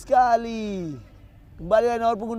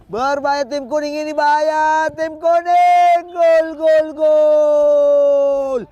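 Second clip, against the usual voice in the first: goal call; 5 semitones higher, 8 dB louder, slower than usual. Real, not acted. Danger builds into goal.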